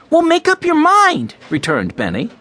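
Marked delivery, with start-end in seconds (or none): 0.1-1.3 put-on very high, loud child's retort; exasperated young boy. A narrator's reading. Benny retorting to Mike in their squabble, exasperated. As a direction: fire back in a high little boy's voice.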